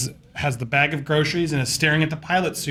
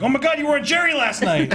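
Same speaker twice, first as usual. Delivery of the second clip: excited gossip; 10 semitones higher than usual. Retold or imitated.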